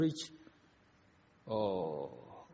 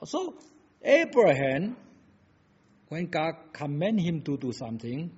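usual speaking voice

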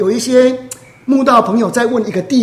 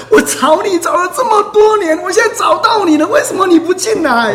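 tearful protest; 8 semitones higher than usual. Retold or imitated. Woman weeping to Jesus after years of searching, tearful protest. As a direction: imitate a weeping, desperate protest. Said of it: "他一直哭啊".

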